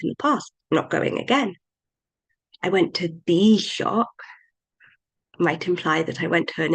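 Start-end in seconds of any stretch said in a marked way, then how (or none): 2.6-4.1 THE drawn out as "thee"; stress on THE. Shown as a demonstration. Teacher showing emphasis on a different word, stress on the. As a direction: punch the word the, slowing into it. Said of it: "put pressure and emphasis on a different word".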